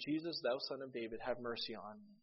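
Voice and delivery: ordinary talk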